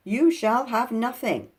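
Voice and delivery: loud, slow defiant cry; cried out. Acted. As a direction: fling the refusal at him. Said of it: "cried Binky".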